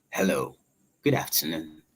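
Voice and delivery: low, soft, self-assured greeting; confident introduction. Retold or imitated. Introducing oneself to a stunning lady, confident low voice. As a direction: drop deep and quiet, sure of your voice. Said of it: "I was so confident in my voice".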